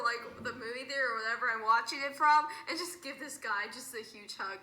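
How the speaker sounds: normal talking voice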